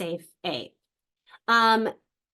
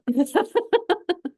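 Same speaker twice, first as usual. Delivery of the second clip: a short embarrassed high laugh; real awkward chuckle; 9 semitones higher, 6 dB louder than usual. Real, not acted. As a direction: laugh off the silence. Alone on camera, no response.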